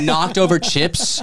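ordinary talk, nothing marked

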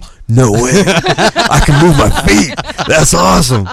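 high, loud, slow laughing delight; delighted surprise. Retold or imitated. Finding the sticky stage fixed, high laughing delight. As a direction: gush with a laugh at the clean floor.

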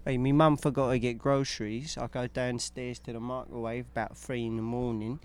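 put-on working-class English accent; British accent. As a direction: tell it as a cheeky British lad. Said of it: "I feel like a British accent".

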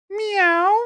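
high mock meow; cat meow. Cat punchline, a high mock meow.

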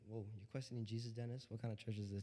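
very low, soft, quick aside; hushed mock objection; mock disapproving aside. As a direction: imitate a scandalized churchgoer under the breath. Voices a doubting churchgoer, aside.

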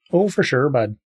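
tossing off the phrase in a mock Canadian accent. Shown as a demonstration. Player shows he can only do Canadian phrases, mock Canadian accent. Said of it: "I can do just the phrases".

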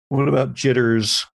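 ordinary talk

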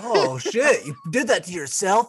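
laughing, hammed-up taunt; mock insult. Friend acting the biker mocking the lip ring, rising high.

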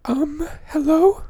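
very high, slow put-on hello; falsetto caller voice. Prank caller greeting the hosts in a very high voice. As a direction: answer the phone in a squeaky put-on voice.

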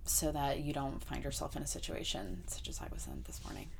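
whispered mock warning; soft whispered moral of the story. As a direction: hush it like a secret PSA. Mock public service warning.

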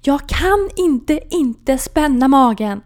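slow, high, loud panicked scream. Retold or imitated. Patient screaming at nurse in panic, loud slow scream. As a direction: howl it out in full panic. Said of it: "skriker jag rakt ut".

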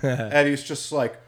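ordinary talk

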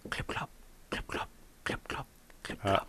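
hushed clip clop like distant hooves; whispered hoofbeats. Pretend. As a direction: whisper the hooves creeping closer. Spooky forest story, hoofbeats approaching.

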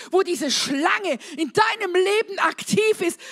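high-energy preacher rallying the congregation; impassioned preaching. Real, not acted. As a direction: preach it with rising conviction, punching key words. Preaches at full force.

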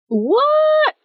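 high-pitched, drawn-out disbelief; incredulous squeal of a question; astonished. Real, not acted. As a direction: let the voice leap up in shock. Shocked at forty-five hours played.

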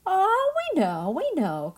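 high, pushy insistence; eager cry. A narrator's reading. Sisters pretending to know the answer, eager cry. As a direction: cover the lie fast. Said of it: "cried the two sisters".

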